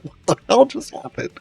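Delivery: high, startled mock voice; confused party member. Party members reacting to the talking sword, high startled. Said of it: "And they were like".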